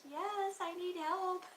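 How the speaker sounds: high, slow, drawn-out plea for help; relieved plea. Retold or imitated. Answering the officer's offer of help with a high slow plea. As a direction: beg for help in a squeaky put-on voice. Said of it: "I'm like".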